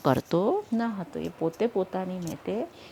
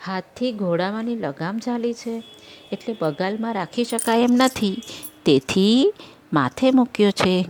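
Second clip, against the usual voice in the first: foolish rider; 4 semitones higher, 6 dB louder than usual. Acted. Foolish rider explains himself.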